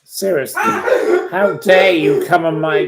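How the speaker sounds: high, loud indignant laugh-shout; outraged laughing rant. Real, not acted. Scolding a chat troll, loud and laughing.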